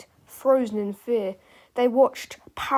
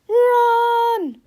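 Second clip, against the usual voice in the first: high, loud, drawn-out shout; urgent cry; 13 semitones higher, 10 dB louder than usual. A narrator's reading. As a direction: yell it out as a long alarm. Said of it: "cried George".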